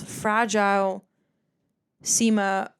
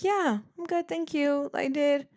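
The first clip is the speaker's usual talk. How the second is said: polite reply; 6 semitones higher than usual. Retold or imitated. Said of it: "I'm like".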